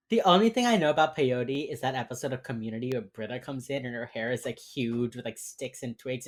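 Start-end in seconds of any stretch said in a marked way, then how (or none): none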